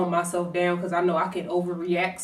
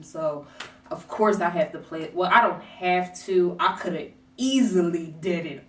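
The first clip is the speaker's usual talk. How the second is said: ordinary talk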